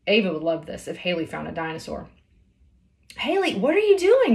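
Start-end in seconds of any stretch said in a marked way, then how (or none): 3.2-4.3 high, loud alarmed call; a friend calling up in alarm; worried call. A narrator's reading. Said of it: "Graceland called out".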